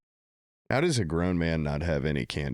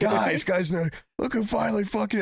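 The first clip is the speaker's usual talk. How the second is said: urgent high pleading; 14 semitones higher than usual. Retold or imitated. Man begging drunk partygoers for candy, high frantic voice. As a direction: get their attention, frantic. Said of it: "I'm like".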